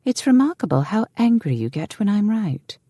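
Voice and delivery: high-pitched woman's needling; taunting retort. A narrator's reading. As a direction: goad him in a high voice. Woman taunts angry man.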